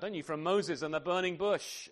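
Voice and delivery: usual speaking voice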